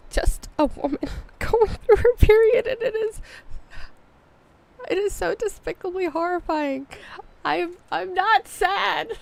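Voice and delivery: high, tearful voice breaking into laughs; about to cry. Real, not acted. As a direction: laugh at yourself while fighting real tears. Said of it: "Why does my voice sound like I'm about to cry?".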